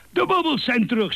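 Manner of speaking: heel hoge voorgelezen schreeuw; paniekerig geschreeuw. A narrator's reading. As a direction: schreeuw het uit als een geschrokken uil. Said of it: "schreeuwde Uil". (hoog, paniekerig schreeuwen)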